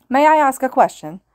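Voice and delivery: loud, high formal outburst; loud exclaim. A narrator's reading. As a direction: call out stiffly to the hero. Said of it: "the student exclaimed loudly".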